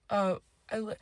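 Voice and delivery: ordinary delivery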